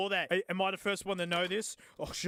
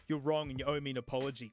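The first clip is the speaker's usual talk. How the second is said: usual speaking voice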